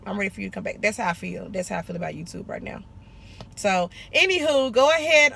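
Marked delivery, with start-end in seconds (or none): 0.0-0.8 soft, coaxing plea to an ex; softer, sweet-talking him back home; sweet-talking an ex into coming back home. Pretend. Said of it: "like when you break up with a dude".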